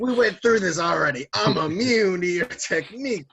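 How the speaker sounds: high, loud exasperated put-on voice; mocking retort. Recapper as Luffy shrugging off lightning, mock exasperated. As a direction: throw it out high and loud as a brag.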